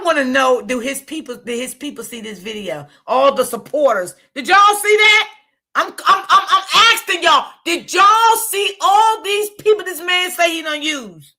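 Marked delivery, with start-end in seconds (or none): 4.3-11.3 higher and louder, laughing disbelief; incredulous. Real, not acted. Vlogger asking his supporters if they saw this, higher and incredulous. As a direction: demand an answer in disbelief.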